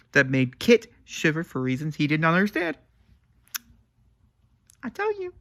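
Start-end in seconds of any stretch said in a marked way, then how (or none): 4.8-5.3 very high, breathy-free gush on "tell"; a high swoon of delight; swooning exclamation. Real, not acted. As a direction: gush over the line, pitch flying up. Gushing over a book line.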